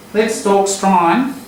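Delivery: Let's Talk Strine said through the nose, slow, broad Australian vowels; nasal Australian accent. Shown as a demonstration. Teacher demonstrating broad Australian for the student. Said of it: "You've got to say it through your nose like that".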